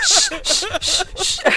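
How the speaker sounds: high urgent shushing; urgent shh; frantic shushing. Acted. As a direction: hush her down fast. Trying to quiet friend.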